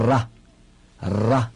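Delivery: 啦 with a tongue-tip trill on the r; rolled r. Shown as a demonstration. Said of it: "弹舌音".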